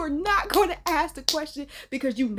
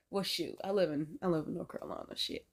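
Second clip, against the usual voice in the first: muttering; 7 semitones lower, 9 dB quieter than usual. Real, not acted. Dawning realisation, muttered.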